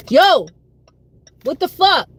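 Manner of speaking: sudden loud annoyed yell; irritated outburst. Real, not acted. Shouting at her buzzing phone, real irritation. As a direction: snap at the noise, loud and high.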